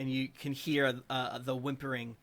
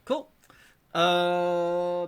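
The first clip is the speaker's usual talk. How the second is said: usual speaking voice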